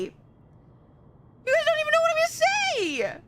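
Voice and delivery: high, slow drawn-out whine; high stretched whine. Pretend.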